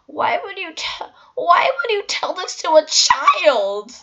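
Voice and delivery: mock a hysterical mother, high; screaming mother.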